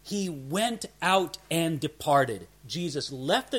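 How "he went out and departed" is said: "went out and departed" drawn out slowly, word by word; very emphatic. Shown as a demonstration. Preacher shows how the Greek puts it, slow and emphatic. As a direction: give "went out" and "departed" slow, separate weight. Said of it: "the Greek puts it very emphatic".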